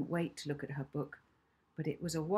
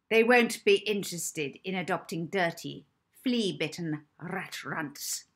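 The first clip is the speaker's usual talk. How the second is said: stern matron; 6 dB louder than usual. Acted. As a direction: boom it down at the children. Matron insults the children.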